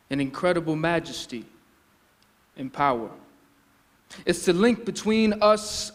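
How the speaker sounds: measured poem recital with deliberate pauses; spoken-word poetry. Acted. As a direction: perform it as stage poetry, phrase by phrase.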